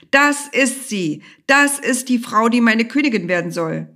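loud, high proclamation; calling out. A narrator's reading. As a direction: raise your voice and announce it like a king's decree. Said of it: "und rief".

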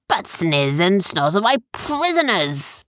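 slow, drawn-out pretend pirate protest; fierce pirate act. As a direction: protest grandly as the play pirate. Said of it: "his fierce pirate mode".

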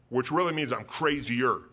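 ordinary delivery

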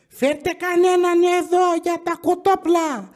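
high, put-on old lady voice; imitating grandma. Grandma demanding chicken at the counter, high mock voice. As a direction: squawk it like a demanding granny.

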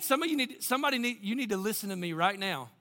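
ordinary delivery

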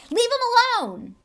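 loud, high desperate shout; shouted command. Acted. Harry yelling at the snake, loud desperate shout. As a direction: yell at the snake to stop it. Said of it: "he had shouted stupidly at the snake".

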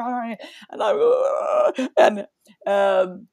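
a high broken sob; tears breaking through the words; real sob. Real, not acted. Crying while running.

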